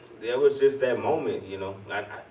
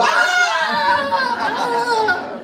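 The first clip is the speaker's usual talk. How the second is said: very high, loud hammed-up wail; mock wailing scream; 6 dB louder than usual. Friend re-enacting how he ran off and fell, mock screaming. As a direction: let out a long theatrical cry, half laughing. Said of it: "that was me. That's how I ran".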